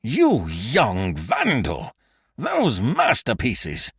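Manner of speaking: slow, indignant scolding; outraged. A narrator's reading. Count scolding Beautrelet for smashing statues, slow outrage. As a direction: scold the vandal, appalled.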